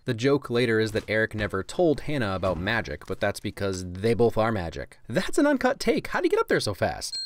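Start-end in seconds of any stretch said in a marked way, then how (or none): 5.1-7.2 pitched high in open amazement; gushing wow at the take; amazed. Real, not acted. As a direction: marvel at the stunt like a fan. Marvelling at a stunt take.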